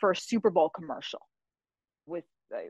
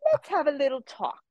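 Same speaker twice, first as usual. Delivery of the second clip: teasing; 8 semitones higher than usual. Retold or imitated. Judge offering advice, retold.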